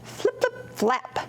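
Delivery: high soft flip flip, then flap; flapping hen sound. Acted. Storyteller voicing the hen flapping up, high and soft. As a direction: flutter the words like wings.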